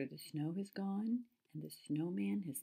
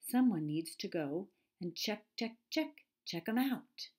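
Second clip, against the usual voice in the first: lightly voiced squirrel, rising high on the repeats; chattering squirrel; 4 semitones higher, 7 dB louder than usual. A narrator's reading. Squirrel urging someone to check the stranger out, chattering. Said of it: "chattered the squirrel".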